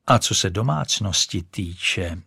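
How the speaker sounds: plain reading voice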